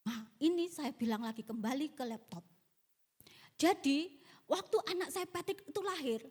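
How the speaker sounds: usual speaking voice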